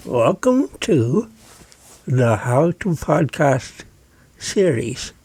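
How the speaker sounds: slow, garbled words round a pen held in the teeth; muffled demo speech past a pen. Shown as a demonstration. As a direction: talk with a pen clamped across your mouth. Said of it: "I'm putting the pen in my mouth right now".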